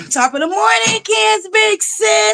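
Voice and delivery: very high, drawn-out hello; singsong greeting. Real, not acted. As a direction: stretch out a high-pitched welcome to arriving viewers. Greeting viewers as they join.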